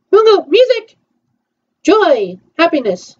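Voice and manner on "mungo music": calling out. Pretend. Calling out an order.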